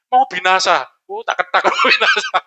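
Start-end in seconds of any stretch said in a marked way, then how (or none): 1.7-2.4 very high, comic squeal rising at the end; hammed-up high-pitched wail; mock alarm. Preacher mocking disciples who only report doom, mock alarm. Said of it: "ngasih kabar aja".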